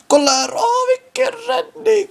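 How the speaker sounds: high squealed disbelief; amazed cry. Real, not acted. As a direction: gasp at the save. Amazed at a keeper save.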